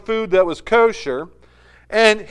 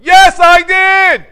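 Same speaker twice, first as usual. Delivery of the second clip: slow, much higher and louder roared admission; furious shout; 10 semitones higher, 14 dB louder, much slower than usual. Retold or imitated. Colonel roaring his confession in court, full shout. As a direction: bellow the confession. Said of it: "he looks at him and says".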